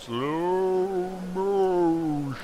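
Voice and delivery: very slow, stretched words; slow-motion speech. Pretend. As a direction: drag every sound out like a slowed tape. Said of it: "we can actually go to, like, slow motion".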